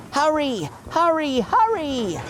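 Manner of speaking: high, rhythmic puffing hurry; puffing like Gordon. A narrator's reading. Thomas pretending to be Gordon, puffing. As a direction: chug it like a big engine. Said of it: "he puffed".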